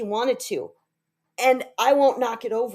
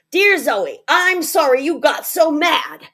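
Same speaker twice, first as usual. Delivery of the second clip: put-on loud, higher, exasperated kid's apology; grudging, angry apology; 5 semitones higher, 7 dB louder than usual. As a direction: fire off a cross, insincere sorry. Jack's grudging, frustrated apology note.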